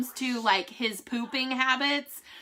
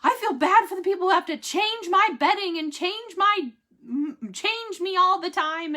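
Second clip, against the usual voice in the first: higher, slightly put-on voice of a guilty husband; voicing her husband; 8 semitones higher, 3 dB louder than usual. Wife voicing husband's guilt about nurses changing him. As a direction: play him sheepish and apologetic. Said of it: "He's like".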